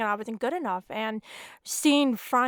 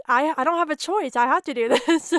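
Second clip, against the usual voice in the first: louder, higher, breaking into a laugh; laughing insistence; 4 semitones higher, 6 dB louder than usual. Real, not acted. Writer admits she must keep querying, laughing insistence. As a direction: insist helplessly and let a laugh through. Laughing admission of having no choice.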